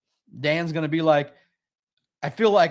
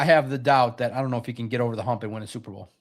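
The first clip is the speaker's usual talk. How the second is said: usual speaking voice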